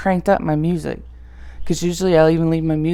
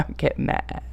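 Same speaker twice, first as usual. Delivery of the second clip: ordinary talk, nothing marked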